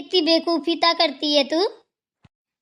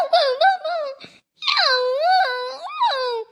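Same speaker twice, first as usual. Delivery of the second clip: animal noises; 8 semitones higher, much slower than usual. Retold or imitated. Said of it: "कुत्ता बिल्ली की आवाजें निकालती है".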